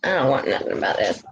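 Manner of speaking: low, fast grumpy cat voice; a put-upon pet's low, fast voice; voicing the cat. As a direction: speak for the cat in a low, quick grumble. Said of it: "She's like".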